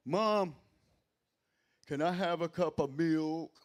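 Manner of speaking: loud, lightly voiced kid asking mom; little kid. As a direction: call out to mom like a small child. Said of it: "I feel like I'm a little kid".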